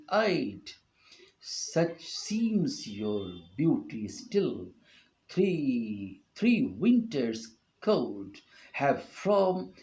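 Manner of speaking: slow, measured poetry recital; slow sonnet reading with weight on the stressed beats; reading a sonnet aloud. Shown as a demonstration. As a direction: read it like verse on a stage, lingering on each beat. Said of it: "Here is an example".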